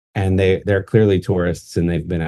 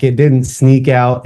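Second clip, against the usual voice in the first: usual speaking voice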